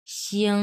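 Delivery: শিং as a gliding "shi-ong", held level; slight "yong". Shown as a demonstration. Teacher repeating the corrected word, gliding "shyong". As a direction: let a "yo" slide into শিং. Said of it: "স্লাইটভাবে ইয়ংটা আসতে হবে".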